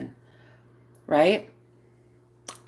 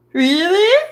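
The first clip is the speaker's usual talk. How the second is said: surprised; 8 semitones higher, 5 dB louder than usual. Retold or imitated. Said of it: "I was like".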